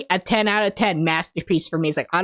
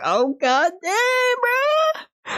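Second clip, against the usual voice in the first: very high, long-drawn fanboy wail; overwhelmed wail; much slower than usual. Real, not acted. Fan reacting to the hand-holding, overwhelmed wail. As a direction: let it climb into a stretched, squealed cry.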